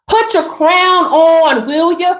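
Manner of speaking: slow, stretched "crown on"; slow, emphatic repeat; drawn-out command. Pretend.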